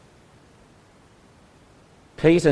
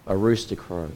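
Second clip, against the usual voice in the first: ordinary delivery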